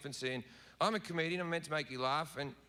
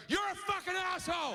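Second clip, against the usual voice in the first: high yelled insult; angry shout; 12 semitones higher than usual. Retold or imitated. Karen confronts the comedian, high furious shout. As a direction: scream it at him, pitched way up. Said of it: "she'll go".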